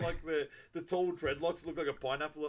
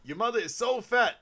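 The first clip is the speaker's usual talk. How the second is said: high, loud roast delivery; imitated comic, pitched up and emphatic; insult comic act; 8 semitones higher, 7 dB louder than usual. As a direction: land it like a stand-up's big put-down. Acted insult-comic joke on stage.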